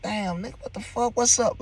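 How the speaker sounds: high, hushed disbelief rising; stunned, pitch climbing as you greet him; shocked greeting. Retold or imitated. Greeting his wasted friend, high and stunned.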